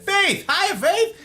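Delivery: high, loud call of welcome; excited greeting. Real, not acted. Streamer spotting Faith in chat, excited hello. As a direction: call out the name with delight. Greeting a viewer who arrives.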